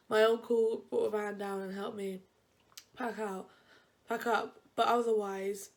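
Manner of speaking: ordinary delivery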